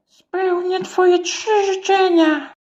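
high slow goldfish; high slow fish voice. Acted. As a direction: grant wishes in a high slow voice. Goldfish speaks.

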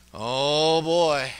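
loud mock-sarcastic oh boy; drawn-out sarcastic exclamation. Preacher to congregation, loud sarcastic oh boy. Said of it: "I'm getting sarcastic now".

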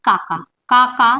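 কাকা, কাকা said slowly and deliberately; slow demo. Shown as a demonstration. Teacher slowly saying কাকা for the class. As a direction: drag out কাকা so they hear it. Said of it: "ধরো কাকা থাকে".

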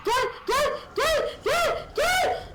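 rising, laughing calls of gel; laughing shout. Real, not acted. Laughing, calling monster over.

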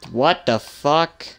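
loud, slow "What the fuck?" with a rising end. Real, not acted. Player reacting to the same screen, loud and drawn out. Startled by the game.

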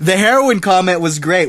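ordinary talk

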